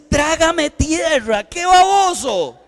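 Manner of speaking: high, loud, slow groan of shame; mortified outburst. Retold or imitated. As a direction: wish the ground would swallow you. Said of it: "Esos son los momentos donde uno dice".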